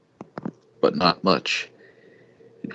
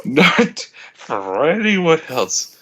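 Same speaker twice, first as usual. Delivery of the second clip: very slow, higher and drawn out; 7 semitones higher, much slower than usual. Acted. Reading the heroine's promise, very slow and drawn out. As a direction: stretch every word out. Stretched out dramatically.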